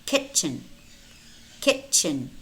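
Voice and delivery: kitchen with no separate T before the CH; silent T. Shown as a demonstration. Teacher models silent letters for learners, silent T in kitchen. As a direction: say kitchen smoothly as "kichen". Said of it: "but it's not pronounced".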